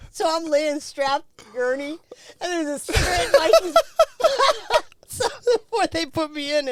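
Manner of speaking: breaking up laughing, high and loud; can't get the words out for laughing. Real, not acted. Telling a friend about the cigarette, laughing hard.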